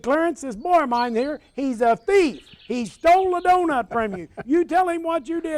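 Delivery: high, loud retold scolding; retold hollering mother. Mother scolding her son in front of the grocer, high hollering. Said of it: "Mom, Mom's hollering".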